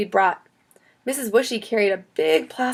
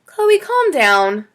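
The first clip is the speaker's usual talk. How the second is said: slow, raised-voice calming; calming teacher; 7 semitones higher, 6 dB louder, much slower than usual. A narrator's reading. Teacher settles Chloe after her scream, calming. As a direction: draw it out to settle a panicking child.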